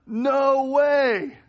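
high, loud thrilled exclamation; retelling a delighted shout at the gas pump; excited disbelief. Retold or imitated. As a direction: blurt it out like you can't believe your eyes. Excited greeting to car owner.

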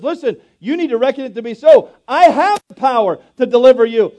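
loud, high preaching that rises and booms; full-force preaching. Acted. Preacher booming God's promise at the congregation. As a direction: preach it out to the whole room.

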